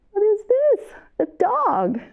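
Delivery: put-on high excited question to little kids; reading to a toddler. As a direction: ask it like a storytime guessing game. Asks children during song.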